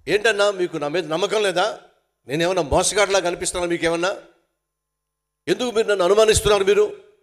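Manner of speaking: loud, fast and offended, protesting innocence; indignant outburst. Retold or imitated. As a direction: snap back as if insulted by the question. Defensive brother answering office staff. (కోపంగా అరుస్తూ)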